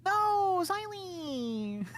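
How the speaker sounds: high-pitched mock cry then laugh; high squeal.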